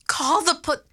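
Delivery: high frantic cry, cut off; panicked outburst; loud gasp. Acted. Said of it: "she loudly gasped".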